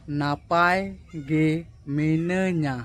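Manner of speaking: নাপায় গে মিনাñা drawn out slowly and evenly; slow teaching model. Shown as a demonstration. Teacher modelling a Santali phrase slowly for viewers. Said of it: "slowly slowly বলবো".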